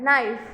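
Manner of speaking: नाइफ said as "naif", no K sound at the start; silent K in knife. Shown as a demonstration. Teacher showing the class that नाइफ has a silent K. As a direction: say नाइफ with the K dropped. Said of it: "के silent है".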